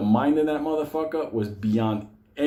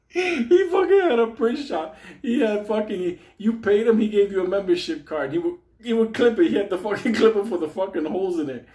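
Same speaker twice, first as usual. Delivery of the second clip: laughing while telling; 9 semitones higher, 4 dB louder than usual. Real, not acted. Laughing through the story.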